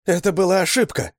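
high, loud cry of protest; woman screaming. A narrator's reading. Woman tells Seventh it was an accident, desperate scream. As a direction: shout it desperately in a high female voice. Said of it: "закричала женщина".

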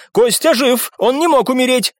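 high-pitched stubborn protest; heated outburst. A narrator's reading. Viscount refusing to accept Костя's death, heated. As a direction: insist hotly. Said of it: "вспылил виконт".

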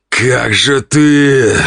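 very slow, barely held-back growl; growled in irritation. A narrator's reading. Count barely restraining irritation at his wife, growled. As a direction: grind it out, holding back rage. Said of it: "прорычал он, едва сдерживая раздражение".

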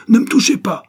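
very loud, high-pitched furious bellow. A narrator's reading. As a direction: bellow it to fend someone off. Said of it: "Bart qui beugle". (beuglement furieux, très fort et aigu)

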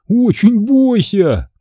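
high, slow mocking imitation; Амг. A narrator's reading. Boy mimicking the man for friends, mocking. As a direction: stretch out a hammed-up spooky warning. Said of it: "очень похоже, передразнил мистера Амга".

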